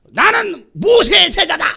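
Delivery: high, loud, slow indignant declaration; hammed-up furious boast; angry Pharisee. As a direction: shout it out in acted outrage. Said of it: "막 욕을 하면서 막 승질을 내요".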